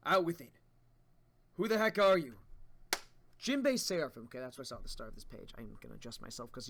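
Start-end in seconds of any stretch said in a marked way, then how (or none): 0.1-4.2 loud angry demand; demanding shout. Acted. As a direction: confront the stranger. Voices confrontation in manga.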